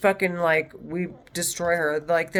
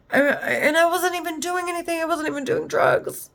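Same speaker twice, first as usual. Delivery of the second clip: real tears breaking through, higher; crying; 8 semitones higher than usual. Real, not acted. Pleading her innocence while she cries, higher and tearful. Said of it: "I had sat and I cried".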